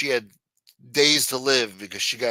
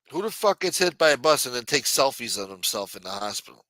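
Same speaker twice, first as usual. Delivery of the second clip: normal talking voice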